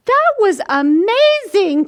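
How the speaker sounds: cheering. Acted. Square cheering Sam's rolling, high excited cheer. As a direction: cheer it like a fan. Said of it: "cheered a square".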